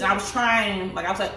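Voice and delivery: ordinary talk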